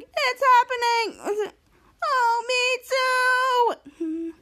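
high, stretched-out mock wail; hammed-up whine of distress; retold desperation. Acting out desperate kids needing to pee.

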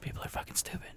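mock grumbling whisper under breath; muttered whisper. Host mocking cohost's grumpy mood in a whisper. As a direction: whisper it like a sulky aside. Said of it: "I'm gonna be over here like".

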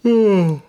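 a big, high, loud sigh of relief; loud shaky sigh. A narrator's reading. Ma sighs after the scare, loud high sigh. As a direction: let out a heavy sigh, overcome.

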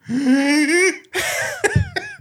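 shrill, high burst of laughter; delayed high laugh. Retold or imitated. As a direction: cackle out a sudden high laugh. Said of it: "şöyle bir ses geliyor".

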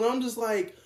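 usual speaking voice

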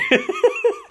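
very high, squealing happy giggle; gleeful laugh. Acted. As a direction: giggle with pure delight. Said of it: "He's so happy".

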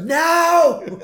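high, loud, drawn-out mock "No!"; impatient shout. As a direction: cut in with a hammed-up yell. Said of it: "Burns has no tolerance for the hippies".

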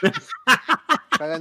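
high, stretched out laughing; slow, high laugh-stretched wait. Real, not acted. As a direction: drag it out through laughter. Laughing at the sexy voice.

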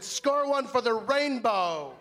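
slow, high, gloating; triumphant quip. Retold or imitated. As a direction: savor the little victory. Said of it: "I said".

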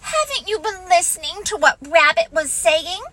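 reproachful Piglet. Acted. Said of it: "said Piglet reproachfully".

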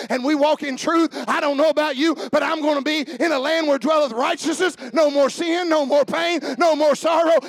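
loud, high rising preacher's cry; full-force preaching. Acted. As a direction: build to a triumphant shout.

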